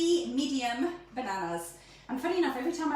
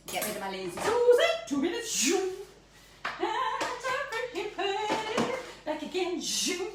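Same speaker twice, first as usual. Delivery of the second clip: playful zoom; 9 semitones higher than usual. Pretend. Speeding up the clear away.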